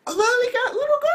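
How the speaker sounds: very high, loud hammed-up shriek; over-the-top scandalized outburst; mock shock. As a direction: squeal it in exaggerated disbelief. Acts out his shocked reaction.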